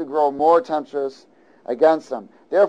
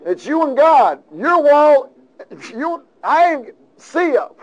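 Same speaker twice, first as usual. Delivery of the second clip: much higher, louder playful taunt; teasing call-out; 11 semitones higher, 6 dB louder, slower than usual. Acted. Preacher taunting Jonah about his wall, high playful. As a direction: call him out, slow and playful on the end.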